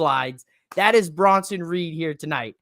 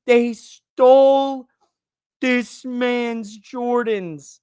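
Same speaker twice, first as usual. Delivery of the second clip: "stole" drawn out loud, the rest slow; incredulous emphasis; 3 semitones higher, 4 dB louder, much slower than usual. Real, not acted. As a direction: stretch every word of "They stole" in disbelief. Mock outrage at the shoe theft.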